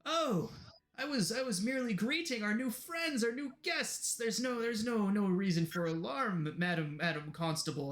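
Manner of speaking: high-pitched mock innocence, easing down; smooth it over with hammed-up innocence; innocent placating. Troublemaker placates the angry constable.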